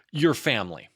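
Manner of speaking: mildly exasperated emphasis; emphatic. Acted. Rabbit repeating emphatically.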